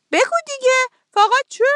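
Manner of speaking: a fox demanding an answer; urgent curious fox; impatient fox. A narrator's reading. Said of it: "روباه پرسید".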